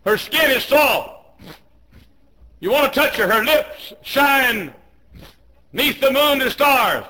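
high, loud shouted sermon; full-force preaching. Real, not acted. Preacher warning teenagers about temptation, full-force shout. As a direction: shout each phrase to the back pew.

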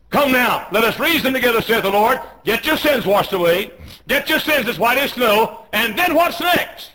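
loud, high preacher's shout; full-force preaching. Real, not acted. Preacher urging reasoning from Isaiah, full-force shout. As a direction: cry the verse out to the whole church.

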